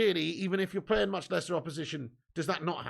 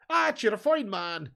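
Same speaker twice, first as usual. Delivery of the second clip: slow, hammed-up Irish accent, a bit louder; a clumsy stage-Irish lilt, drawn out; bad Irish accent; 2 semitones higher, 6 dB louder than usual. Thanking a viewer in a mock Irish accent. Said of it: "That's a proper shit accent there".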